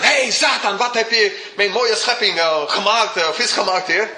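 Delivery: a hammed-up divine summons; God calling Satan. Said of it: "Hij roept niet aan Satan".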